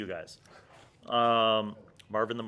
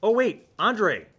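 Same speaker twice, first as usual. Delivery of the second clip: excited shout-out; 6 semitones higher, 8 dB louder than usual. Real, not acted. Excited shout-out to Romanian viewer.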